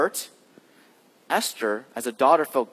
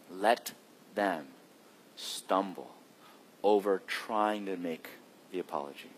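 hushed, slow urging; 6 semitones lower, 7 dB quieter than usual. Acted. Hushed sermon exhortation.

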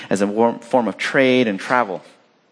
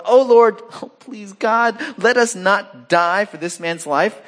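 crying out in prayer; 9 semitones higher, slower than usual. Acted. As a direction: beg God for your life. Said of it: "they called out to the Lord".

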